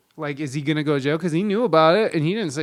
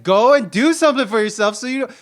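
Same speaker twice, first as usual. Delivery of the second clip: higher, louder nagging push; retold urging; 6 semitones higher, 7 dB louder than usual. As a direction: urge the wife, half-shouting. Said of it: "And it's like".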